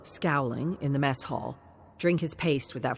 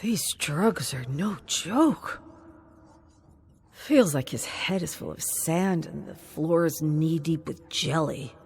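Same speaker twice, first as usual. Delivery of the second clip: plain reading voice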